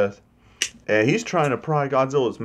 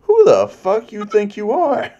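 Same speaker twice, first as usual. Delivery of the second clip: furious Kong; 10 semitones higher, 6 dB louder than usual. Retold or imitated. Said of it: "And Kong's just like".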